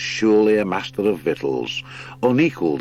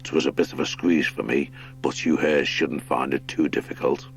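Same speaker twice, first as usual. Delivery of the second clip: badger lord; 4 semitones lower than usual. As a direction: lead them through like a big old lord.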